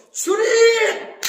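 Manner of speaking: long, high, very loud call to God; crying out. Acted. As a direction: draw the word out in a full acted shout. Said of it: "계속 소리 질렀어". (길게 목청껏 부르짖음)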